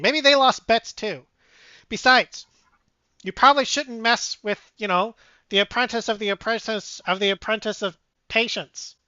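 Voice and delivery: fast, squeaky-ish scolding mouse; nervous mouse lecturing, rushed. Acted. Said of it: "the mouse speak up".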